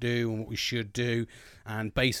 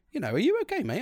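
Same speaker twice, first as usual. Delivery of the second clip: wife's concern; 11 semitones higher, faster than usual. Retold or imitated. Wife asks if he's okay.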